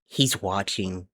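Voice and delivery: flat, slow monotone delivery. Shown as a demonstration. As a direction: say it in a dead monotone voice. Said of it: "In a monotone voice".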